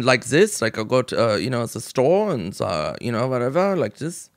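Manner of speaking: put-on casual improvised German accent; off-the-cuff German-accented rambling. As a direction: try out a German accent on the spot. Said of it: "can you do a German accent".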